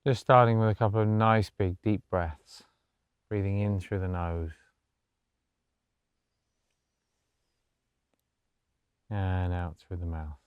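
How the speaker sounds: soft, low-key meditation voice with long pauses; guided meditation. Acted. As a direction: gently guide them into stillness, hushed and unhurried.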